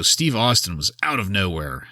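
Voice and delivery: usual speaking voice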